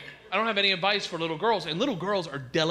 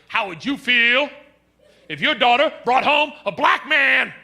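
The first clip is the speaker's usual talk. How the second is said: challenging friend; 6 semitones higher, 8 dB louder than usual. Retold or imitated. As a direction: put the hard question to him. Friend quoted loudly.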